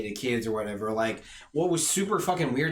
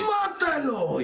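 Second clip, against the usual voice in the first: high, slow hammy villain shout; cartoon villain; 13 semitones higher, much slower than usual. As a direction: bark it like a cartoon bad guy. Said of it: "the most cartoon bad guy ever".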